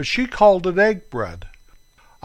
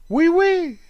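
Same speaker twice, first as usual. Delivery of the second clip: high, louder hammed-up French; mock French oui oui; 4 dB louder than usual. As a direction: belt it high like a cartoon Frenchman.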